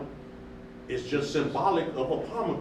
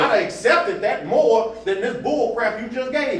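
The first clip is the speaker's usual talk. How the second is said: exasperated, higher and louder; heated; 8 semitones higher, 8 dB louder than usual. Real, not acted. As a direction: let the frustration boil over. Still heated after the quote.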